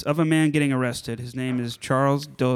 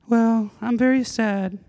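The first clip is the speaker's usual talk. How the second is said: sad masked oddball woman; 8 semitones higher than usual. Acted. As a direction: confess it as an awkward, mournful guest. Plays Stephanie, a sad guest.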